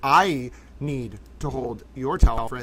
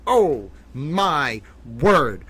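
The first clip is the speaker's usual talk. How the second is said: stunned exclamation; 5 dB louder, much slower than usual. Real, not acted. Stunned streamer awaiting jury verdict.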